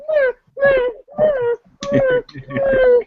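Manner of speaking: high crying puppy whine; whiny dog whimper. Retold or imitated. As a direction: whimper like a needy dog, drawn out. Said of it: "but you gotta whine?".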